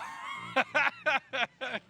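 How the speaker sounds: high burst of laughter; loud laugh. Real, not acted. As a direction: crack up. Hosts crack up on air.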